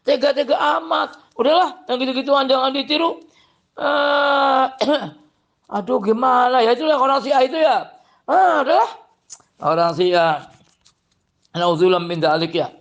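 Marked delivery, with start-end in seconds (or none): 0.0-8.9 much higher, pained exclamations; exasperated disbelief. Real, not acted. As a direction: sigh in dismay.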